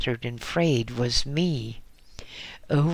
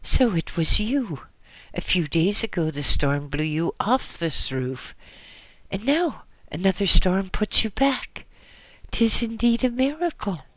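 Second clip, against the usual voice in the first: put-on astonished, higher, louder old monk; exclaiming; 7 semitones higher, 3 dB louder than usual. A narrator's reading. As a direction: marvel aloud at a miracle. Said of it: "he exclaimed".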